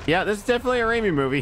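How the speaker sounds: quick, high delighted remark; excited. Retold or imitated. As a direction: blurt it out delighted. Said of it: "I was like".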